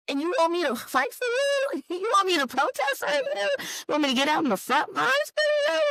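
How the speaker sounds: high, rising outrage at voters; indignant disbelief. Real, not acted. Indignant rhetorical questions.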